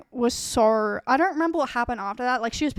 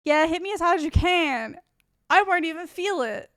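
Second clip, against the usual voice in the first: higher, louder imitation of a drunken boaster; mock drunk bravado; 5 semitones higher, 5 dB louder than usual. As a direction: egg them on like a cocky drunk. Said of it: "when people get drunk and they just start getting really fucking stupid".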